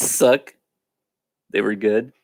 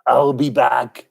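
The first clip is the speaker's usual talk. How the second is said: slow Austrian action-hero promise; Arnold Schwarzenegger; slower than usual. Acted. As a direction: draw it out like an Austrian action star. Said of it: "can't do his voice".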